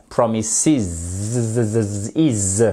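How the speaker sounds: "Promises" with a long buzzing -iz ending; drawn-out ending. Shown as a demonstration. Teacher showing learners the plural, "Promises" ending in drawn-out iz. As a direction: stretch the final "is" of "Promises" into a buzzed iz. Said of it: "le même son que vous avez ici".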